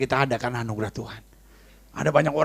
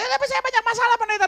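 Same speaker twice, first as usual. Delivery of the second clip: very high-pitched mock complaint; congregant's protest. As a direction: put on a high objecting churchgoer's voice. Voices a congregant's objection.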